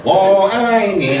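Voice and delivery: slow, drawn-out mock serenade; hammed-up Mandarin. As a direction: stretch out each Mandarin syllable for comic effect. Jokey Mandarin phrase, hammed up.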